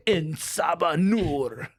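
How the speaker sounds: slow, stretched mock proclamation; hammed-up cartoon intoning; grandiose name reveal. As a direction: drag it out with huge mock grandeur. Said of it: "it's always Insabanur".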